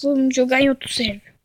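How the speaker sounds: normal talking voice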